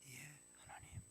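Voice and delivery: 숨죽인 순종의 속삭임; 조용히 속삭이며 대답하기; whisper. Retold or imitated. Grandfather answering God hushed. (속삭이는 대답)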